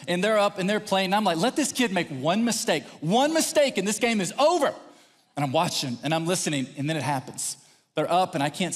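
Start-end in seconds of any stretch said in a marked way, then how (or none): 1.4-4.7 acted threat rising in pitch; mad. Dad watching for one mistake, mock mad. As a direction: warn with mounting mock menace. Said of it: "But now I'm mad".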